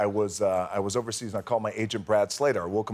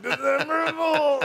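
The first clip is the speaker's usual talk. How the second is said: drawn-out reveal shout; slower than usual. Shown as a demonstration. Showing the host how to say it, a long gleeful shout. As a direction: belt December Fool slowly, gleeful. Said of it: "you gotta say it like that".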